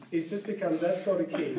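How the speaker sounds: normal talking voice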